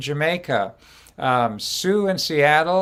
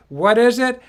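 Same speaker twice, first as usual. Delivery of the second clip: quizzing the audience; 8 semitones higher, 6 dB louder than usual. Shown as a demonstration. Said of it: "I have you say it back".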